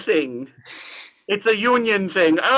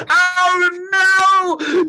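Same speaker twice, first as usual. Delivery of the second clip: higher, louder wail; anguished cry; 6 semitones higher, 4 dB louder than usual. Acted. Scrooge wailing at his grave, anguished cry. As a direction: wail it in despair.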